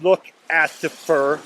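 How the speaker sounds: Asifer said slowly as ass-ifer for officer; slip-of-the-tongue Asifer. Pretend. Cheeky speaker to beach cop: slow, deliberate Asifer. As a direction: drawl Asifer like an insult to officer. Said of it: "I meant to call you officer".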